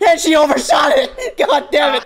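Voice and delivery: high, loud shout with laughter in it; exasperated yell. Real, not acted. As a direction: yell in amused frustration. Yelling at pit mistake.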